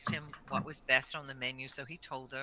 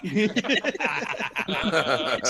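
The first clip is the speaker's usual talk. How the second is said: loud laughter; 2 semitones higher, 7 dB louder than usual. Real, not acted. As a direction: crack up loudly. Laughs at her own prank.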